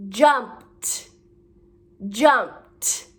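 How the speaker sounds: "jumped" with ED as a released t; audible t ending. Shown as a demonstration. Teacher modelling the next word, "jumped" ending in crisp t. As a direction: say "jumped" alone, t set apart at the end. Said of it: "Do you hear the t?".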